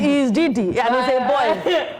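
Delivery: slow, stressed disbelief; slow incredulous. Real, not acted. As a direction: drag it out in disbelief. Incredulous emphasis.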